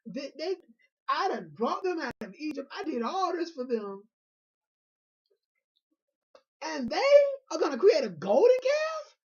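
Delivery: high, loud furious outburst building to a near-cry; a wrathful God, voice cracking as it climbs; outraged God yelling about the golden calf. Retold or imitated. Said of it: "God got mad".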